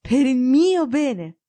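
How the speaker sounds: a slow, incredulous question; a doctor baffled by his friend; astonished echo. Acted. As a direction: echo it back in disbelief.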